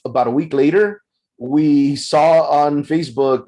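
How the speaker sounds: normal talking voice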